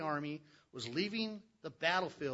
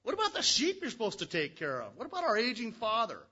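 louder, higher, mock-stern rebuke; scolding brothers; 6 semitones higher, 5 dB louder than usual. Older brothers scolding David for leaving the sheep, mock-stern. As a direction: rub his duties in his face. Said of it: "they got mad".

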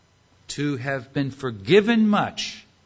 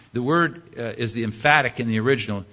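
ordinary talk

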